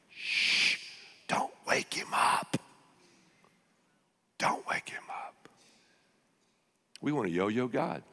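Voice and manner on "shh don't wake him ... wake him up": hushed whisper, slow; whispering over a crib. Acted. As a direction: whisper so the baby sleeps.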